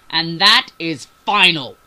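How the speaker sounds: slow, very loud final order; yelling. A narrator's reading. Captain ends the argument, yelling. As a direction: yell it with emphatic finality. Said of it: "he yelled".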